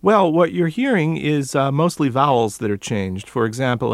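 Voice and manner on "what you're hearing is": normal talking voice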